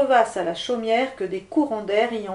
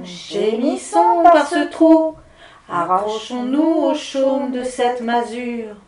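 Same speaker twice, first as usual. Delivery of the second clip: howling wind; 2 semitones higher, 7 dB louder, slower than usual. Acted. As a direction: howl it like a gust through a hole. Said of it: "voix des esprits du vent chuchoter".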